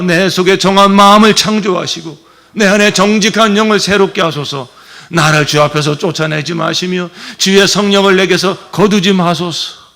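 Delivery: loud, fervent psalm reading; scripture recitation. Shown as a demonstration. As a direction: pray the verses out with force. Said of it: "시작".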